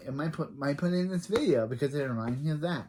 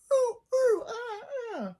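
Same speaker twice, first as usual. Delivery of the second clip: imitated baby noises; slower than usual. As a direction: coo and hoot like a baby, very high.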